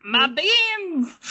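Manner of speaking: high cartoon princess voice; Lumpy Space Princess voice. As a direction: squeal it like a bratty cartoon princess. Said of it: "What's her voice sound like?".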